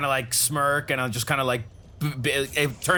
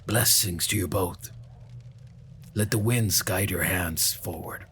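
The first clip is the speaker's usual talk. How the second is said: low, slow, measured in-character voice; solemn blessing; 7 semitones lower, 3 dB quieter than usual. Acted. Speaking to Dahlia about the boy, solemn and low. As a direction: pronounce a grave blessing, low and unhurried.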